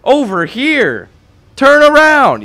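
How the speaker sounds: loud, high mock holler of a game character; imitated yelling. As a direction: yell it out like you're calling someone over. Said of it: "He's, like, yelling".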